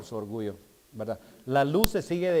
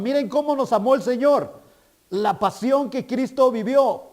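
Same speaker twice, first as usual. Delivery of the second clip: raised, louder preaching of the Passion; full-force preaching; 6 semitones higher, 5 dB louder than usual. Acted. Preacher rouses the congregation about Christ's Passion, full-force preaching. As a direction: build the Passion story up to the crowd.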